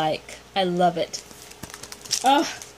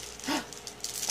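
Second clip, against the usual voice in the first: soft gasp; 3 semitones higher, 12 dB quieter than usual. Real, not acted. Opening a surprise gift.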